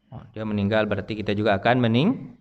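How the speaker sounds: usual speaking voice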